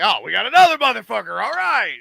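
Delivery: playful imitation of a swaggering killer; mock tough-guy quip. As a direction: rattle it off like a cocky hitman. Retelling Jackson's reaction in show.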